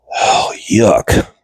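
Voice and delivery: ordinary talk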